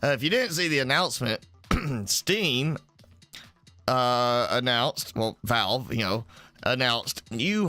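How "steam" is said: usual speaking voice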